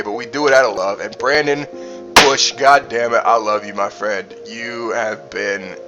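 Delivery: usual speaking voice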